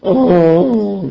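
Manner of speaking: long high groan, falling away; drawn-out "oh" of a complaining moaner; mock moan. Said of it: "he was a moaner".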